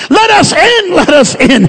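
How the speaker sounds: high pleading shout that drops on the repeat; mock desperate cry. Preacher voices the drowning at the ark's door, desperate cry. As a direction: cry out to be let in, preacher-style. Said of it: "They're crying out, screaming for help".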